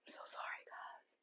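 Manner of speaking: soft unvoiced whisper, barely there; whispered apology. Real, not acted. Tearful mother apologising to viewers, whispering. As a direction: breathe the apology almost silently.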